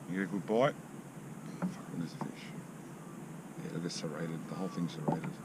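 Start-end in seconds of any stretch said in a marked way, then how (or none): 1.6-5.3 hushed muttered swearing; fishing whisper. Real, not acted. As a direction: breathe it almost unvoiced. Examines frayed line quietly.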